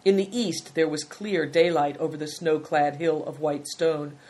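ordinary delivery

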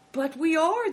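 mildly insistent objection; countering. A narrator's reading. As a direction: protest back, pitch lifted. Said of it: "the innkeeper countered".

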